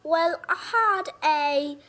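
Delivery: badly faked British sliding into southern; attempted British accent. Shown as a demonstration. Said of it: "review in your British accent".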